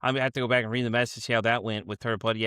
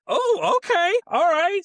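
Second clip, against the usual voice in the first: mock surprise; 7 dB louder, much slower than usual. Reacting to being called a bitch.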